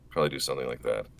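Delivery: normal talking voice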